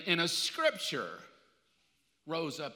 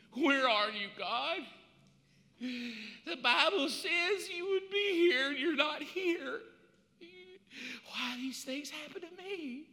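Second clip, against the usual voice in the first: high, slow whiny plea; whining prayer; 7 semitones higher, slower than usual. Acted. Preacher mimicking a whiny believer, high and slow. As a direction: whine to God like a wounded child.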